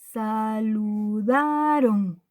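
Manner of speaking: Saludaron stretched slowly with a long DA; slow teacher's Saludaron, weight on da; stress on penultimate syllable. Shown as a demonstration. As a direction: draw out Saludaron, holding the da. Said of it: "Aquí está el golpe de voz".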